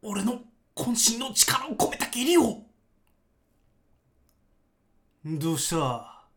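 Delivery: loud, slow, menacing Cell voice; Cell impression. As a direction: sneer it loud like Cell with rolled r. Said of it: "通しで一回モノマネをして". (迫力あるセルのモノマネ)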